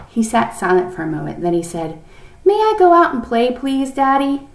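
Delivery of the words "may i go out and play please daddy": put-on high, loud little-boy request; child's plea. A narrator's reading. Boy asking his father to go out and play, pleading. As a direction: ask in a hopeful, raised child's voice.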